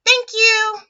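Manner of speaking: high, loud singsong thanks; animated high thank-you; imagined otter thanks. Retold or imitated. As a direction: chirp it gratefully as the otter. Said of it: "he's gonna say".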